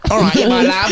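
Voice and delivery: Cockney accent, hammed up. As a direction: sing it out like a Cockney woman. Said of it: "these two Cockney women".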